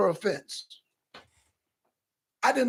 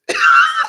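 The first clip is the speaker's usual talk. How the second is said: laugh; 10 dB louder than usual. Real, not acted. Laughing at the rally clip.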